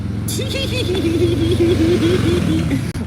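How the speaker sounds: long, loud, high-pitched cackle; loud giggling shriek; squealing laugh. Real, not acted. Her own laugh after corpse question.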